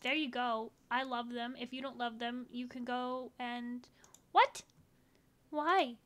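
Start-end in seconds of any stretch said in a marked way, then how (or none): 4.3-6.0 high, loud startled outburst; shocked what. Real, not acted. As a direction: blurt it in disbelief. Shocked that the tag is blocked.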